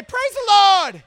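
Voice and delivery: loud, high, drawn-out praise cry; big revival-style call; rousing church shout. Shown as a demonstration. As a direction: holler it slowly to the whole congregation. Calls for congregation's shouted response.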